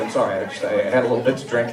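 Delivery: meek, soft, rushed mumble; cowering apology. Retold or imitated. Apologizing drunkenly to furious girlfriend, meek and hushed. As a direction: shrink away and mumble the excuse.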